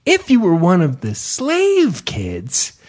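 slow, sing-song storybook voice; lilting teacher voice; kids' tour narrator. Retold or imitated. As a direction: explain it gently to children. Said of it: "They're like".

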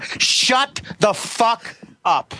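drawn-out disbelief. Real, not acted. Disbelief at the price.

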